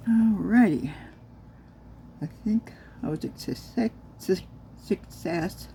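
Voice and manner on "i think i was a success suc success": stumbling over "success"; tongue-tied. Real, not acted. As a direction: trip on "success", break off and retry it. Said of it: "Yes, words are hard".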